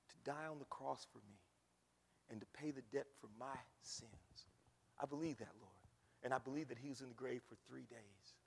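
quiet, low modelled prayer; quiet sinner's prayer; soft prayer. Shown as a demonstration. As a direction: pray it gently, low.

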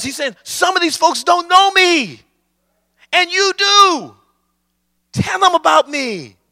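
high-pitched, urgent pulpit cry; impassioned preaching. Real, not acted. Preacher voicing God's challenge to the church, impassioned preaching.

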